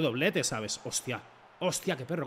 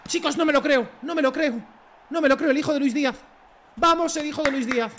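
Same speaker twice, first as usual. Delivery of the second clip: loud, high, can't-believe-it cheer; excited disbelief; 8 semitones higher, 5 dB louder than usual. Real, not acted. Streamer cheers a goal in loud, high disbelief. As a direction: shout it out in joyful shock. Player scores a great goal.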